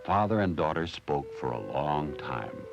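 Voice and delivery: usual speaking voice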